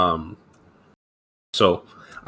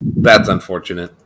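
deadpan sarcasm; 6 semitones lower, 4 dB quieter than usual. Pretend. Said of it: "I'm kidding".

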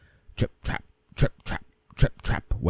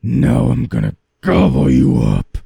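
menacing troll; 8 semitones higher, 11 dB louder, faster than usual. Acted. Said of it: "said the troll".

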